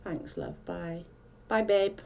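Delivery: normal talking voice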